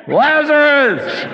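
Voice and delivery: hammed-up command to rise; calling out. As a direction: call him out of the tomb.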